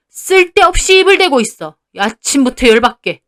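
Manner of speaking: high, loud, irritated scolding; angry father-in-law. A narrator's reading. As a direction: complain furiously about being contradicted. Father-in-law grumbles in anger. (열받아서 쏘아붙이는 시아버지)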